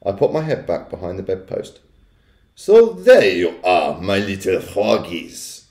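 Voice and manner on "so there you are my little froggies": slow, drawn-out witch voice with a put-on accent. A narrator's reading. Witch finding the frogs under the bed, gloating slowly. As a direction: draw it out like a villainous witch. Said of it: "I heard her saying".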